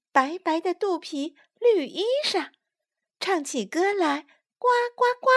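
high, timid little asking voice; small-voiced tadpoles. A narrator's reading. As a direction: ask politely as a tiny tadpole. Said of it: "小声地问".

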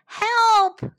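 high, loud cry of alarm; crying for help. A narrator's reading. Plovers to all as machines move in, crying out. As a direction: call out urgently, high and drawn out. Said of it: "cried the plovers".

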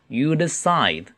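drawn-out teacher's demonstration; slow reading. Shown as a demonstration. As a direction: say it slowly for learners. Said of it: "慢读一次".

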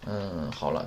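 ordinary talk, nothing marked

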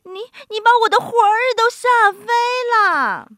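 high, loud, rapid stammering shriek; fast, panicked old woman; frightened cry. A narrator's reading. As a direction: shriek as if scared out of your wits. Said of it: "瘦小的老妇人喊起来".

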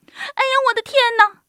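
high, loud startled squeal; old woman crying out. A narrator's reading. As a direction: shriek as a frightened little old lady. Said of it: "瘦小的老妇人喊起来".

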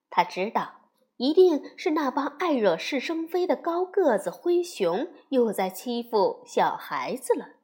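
ordinary delivery, nothing marked

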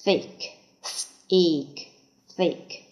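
ordinary talk, nothing marked

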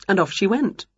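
usual speaking voice

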